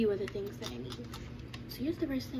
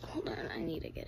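low breathy aside whisper; 6 semitones lower, 8 dB quieter than usual. Real, not acted. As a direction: mutter it under your breath. Reaching for something in closet.

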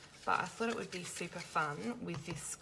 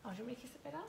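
kissing it better; 6 semitones higher, 7 dB quieter, much faster than usual. Real, not acted. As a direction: comfort a hurt little one gently. Comforting crying son off-camera.

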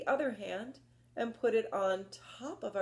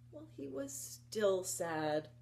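ordinary delivery, nothing marked

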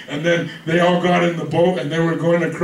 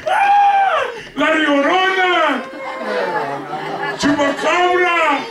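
high-pitched mock-terrified shriek; comic wail at seeing a ghost; scared cry; 9 semitones higher, slower than usual. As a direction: cry out in hammed-up panic, stretching the words. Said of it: "they were crying and they were scared".

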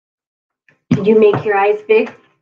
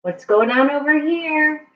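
plain reading voice

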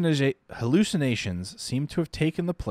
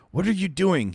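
higher, louder angry shout; doctor shouting; 10 semitones higher, 4 dB louder than usual. Acted. Doctor shouting at Chaim, angry shout. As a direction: snap at him in alarm. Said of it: "he shouts at Chaim".

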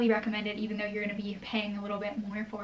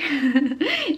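bright burst of laughter, higher and louder; 5 semitones higher, 10 dB louder than usual. Real, not acted. Admitting to viewers she was an overachiever, real laugh. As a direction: laugh at herself mid-sentence.

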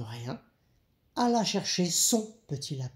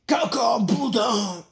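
loud, raised, rough shout; roaring wolf; 6 semitones higher, 6 dB louder, slower than usual. A narrator's reading. Wolf answering his wife, shouting the catchphrase loudly. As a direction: blare it out like a big wolf.

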